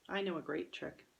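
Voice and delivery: ordinary delivery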